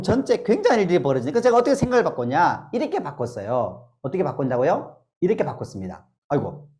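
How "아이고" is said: usual speaking voice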